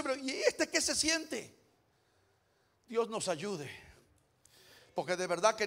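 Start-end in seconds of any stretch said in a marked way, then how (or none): 0.0-1.5 onlookers' indignant question. Retold or imitated. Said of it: "La gente está mirando y dice".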